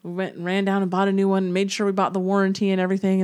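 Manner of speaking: ordinary talk, nothing marked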